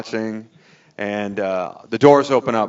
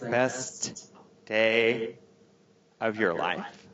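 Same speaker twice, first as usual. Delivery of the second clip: soft, icy disbelief, imitating the wife; quietly pissed; 10 dB quieter, much slower than usual. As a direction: space the words out in hushed reproach. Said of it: "She's pissed".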